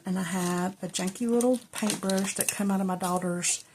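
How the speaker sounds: normal talking voice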